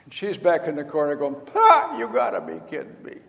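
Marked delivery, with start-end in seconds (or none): 1.5-3.2 high, cackling scoff; mocking cackle. Sarah in the tent corner, cackling in disbelief. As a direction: laugh it off as absurd. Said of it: "cackling because there's no way".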